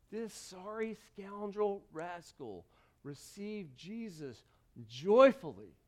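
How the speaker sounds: normal talking voice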